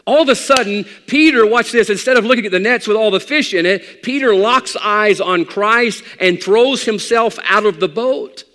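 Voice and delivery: loud, high, driving sermon climb; preaching at full force. Acted. Pastor preaching to his congregation, full force. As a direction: build it like a revival preacher.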